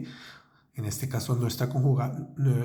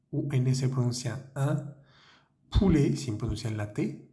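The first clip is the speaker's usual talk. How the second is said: ordinary talk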